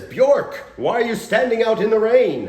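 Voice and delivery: loud, high-pitched English stage voice; English accent. Acted. Scene partner calling his Scandinavian brother, hammed-up English accent. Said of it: "he's using an English accent".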